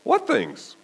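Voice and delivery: high, innocent "what things"; coy mock-innocent question; playing coy. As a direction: feign ignorance, light and high. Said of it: "playing coy".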